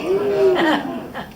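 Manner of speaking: high, put-on woman's voice; female voice. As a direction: squeak it out like a lady. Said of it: "Make it sound like a female".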